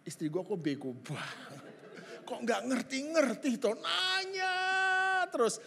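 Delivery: inner voice. Retold or imitated. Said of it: "Dalam hati saya gini".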